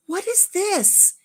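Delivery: high, slow, drawn-out wonder; feigned surprise. Retold or imitated. Grandpa pats his shirt pocket, feigning surprise. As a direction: act astonished by your own pocket.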